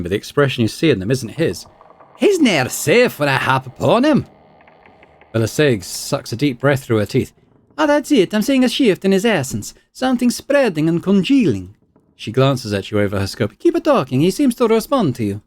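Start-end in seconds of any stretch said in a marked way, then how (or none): 2.2-4.3 a different, sly voice taking over; mocking spirit. Spirit possessing Aloth mocks the player, sly and archaic. 7.8-15.4 high, eager scholar voice; thrilled by the readings; excited researcher. Acted. Scholar reading her scope tells the player to keep talking, high and eager. Belasag voice.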